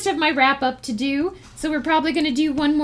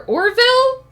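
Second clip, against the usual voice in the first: amused disbelief; 8 semitones higher, 6 dB louder than usual. Real, not acted. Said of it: "Totally different thing".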